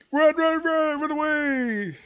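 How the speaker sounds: high, then slow panicked cry to run. Retold or imitated. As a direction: yell at everyone to flee, voice way up. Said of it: "my friend's like".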